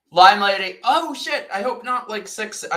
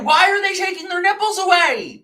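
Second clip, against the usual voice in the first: outraged disbelief; 10 semitones higher, 5 dB louder than usual. Real, not acted. Shocked ranting about nipples.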